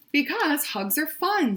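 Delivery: high, bright friendly bear greeting; Bear's friendly voice. Acted. Bear warmly greeting Skunk, high and friendly. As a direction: greet him like a cheerful bear.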